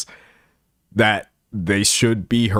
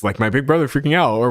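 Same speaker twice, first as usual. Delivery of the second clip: ordinary delivery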